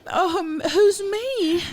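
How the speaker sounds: very high, loud mock British voice; British accent. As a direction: squeal the question in a British accent. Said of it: "I'll do the British accent".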